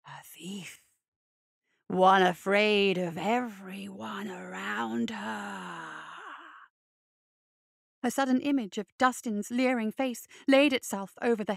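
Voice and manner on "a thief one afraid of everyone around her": low, slow, sneering spirit; a sneer from an old beast; growling spirit voice. A narrator's reading. December sizing her up.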